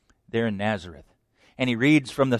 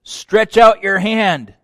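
slow, loud command; 6 semitones higher, 8 dB louder, slower than usual. Acted. Preacher voicing Jesus' command to the withered hand, slow and loud. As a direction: deliver it slowly, with authority.

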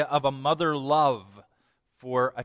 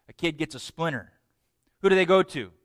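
ordinary delivery

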